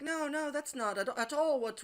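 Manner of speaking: high, flustered mock lady voice; flustered denial. To him, a flustered high mock denial. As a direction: protest in a put-on high voice.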